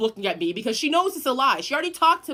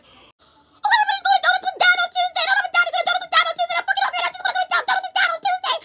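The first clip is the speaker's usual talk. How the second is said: put-on very high, very fast childish whine; whiny little kid; 6 dB louder, much faster than usual. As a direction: squeal it like a whining small child. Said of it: "And I was like".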